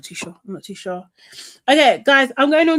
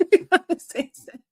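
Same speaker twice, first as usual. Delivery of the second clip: higher, giggly echo of the line; amused repeat; 7 semitones higher than usual. Real, not acted. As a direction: repeat it still laughing. Repeated after laughing.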